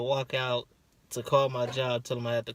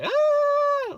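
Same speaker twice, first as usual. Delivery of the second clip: very high loud scream; imitated labor scream; 8 dB louder than usual. Husband imitating wife's labor scream. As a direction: scream like she's in labor down the hall. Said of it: "you can hear her screaming".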